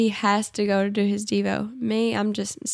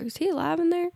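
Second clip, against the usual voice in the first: high-pitched, softer wondering aside; playfully puzzled self-quote; mock wondering; 7 semitones higher, 5 dB quieter than usual. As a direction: wonder it aloud in a high, small voice. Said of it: "I'm like".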